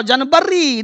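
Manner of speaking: जनवरी said "jan-ba-ree" with a B; drawn-out "jan-ba-reee" for जनवरी; wrong Hindi form. Shown as a demonstration. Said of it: "नहीं बोलना है". (जनवरी को देसी ढंग से "जनबरी")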